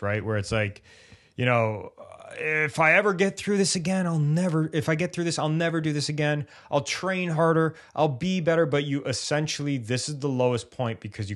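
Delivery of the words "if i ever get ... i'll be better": higher, pleading athlete voice, lightly put on; injured athlete bargaining in a mildly mock pleading voice; desperate bargaining. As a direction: beg yourself a promise in a higher voice. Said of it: "one of those if only stages".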